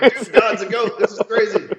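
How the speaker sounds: high, loud, laughing disbelief; excited laughing quote. Retold or imitated. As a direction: blurt it out, amazed and laughing. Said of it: "I'm like".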